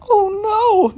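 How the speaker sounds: very high, loud cat shriek; scream. Acted. Missus Hudson screams from her basket, very high. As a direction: shriek in alarm. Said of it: "screamed from her basket".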